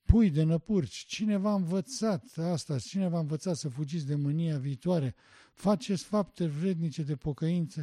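normal talking voice

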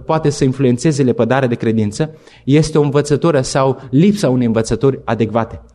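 ordinary delivery

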